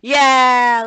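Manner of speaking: loud winning yell; triumphant yell. Real, not acted. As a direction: cheer the win out loud. Winning the fight.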